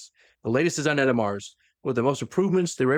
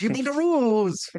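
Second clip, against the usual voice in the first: much higher and slow, outraged; exasperated retort; 12 semitones higher than usual. Acted. Bran snaps back at Korax, indignant. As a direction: throw it back at him, indignant. Acted quarrel between commanders.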